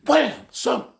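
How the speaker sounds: sudden loud, high mock yell of an angry mom. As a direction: bark it like a mom mid-slap. Mom slapping kids, yelling.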